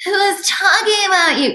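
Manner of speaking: put-on very high, small, childish squeak; replaying her shocked sideline question in a tiny child's voice; five-year-old voice. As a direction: blurt it out like a startled little kid. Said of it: "this five-year-old voice ... came out of my mouth".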